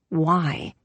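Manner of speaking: ordinary delivery, nothing marked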